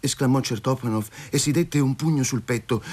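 usual speaking voice

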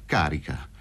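low, cold, falling statement; frigid answer. A narrator's reading. Fazio answers his frightened target, frigidly. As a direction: confirm it icily, pitched well down. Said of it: "rispose frigido il Fazio".

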